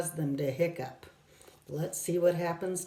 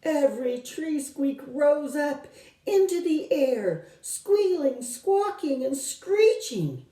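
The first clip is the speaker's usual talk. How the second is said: building excitement; 9 semitones higher, 4 dB louder than usual. A narrator's reading. Narrator builds the shrieking noise.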